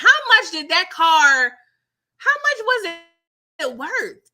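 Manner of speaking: high, loud pressing question; heated question. Acted. Vlogger grilling Toy about the car, heated question. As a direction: demand it, rising in exasperation.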